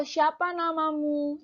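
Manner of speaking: "Siapa namamu?" with the pitch lifted high; question intonation. Shown as a demonstration. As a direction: ask it like a real question. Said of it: "ada penekanan atau intonasi di akhir kalimat".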